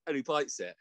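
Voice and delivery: ordinary talk, nothing marked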